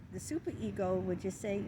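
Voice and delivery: ordinary talk, nothing marked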